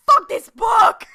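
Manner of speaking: loud, slow shout of outrage; retelling fury at a book, shouting with a cry in it; mock angry yell. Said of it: "I was viscerally angry".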